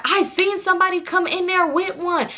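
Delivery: high, insistent arguing friend. Retold or imitated.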